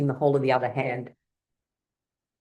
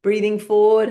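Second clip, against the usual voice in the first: loud cue; 7 semitones higher, 7 dB louder than usual. Shown as a demonstration. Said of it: "leads breathing exercise".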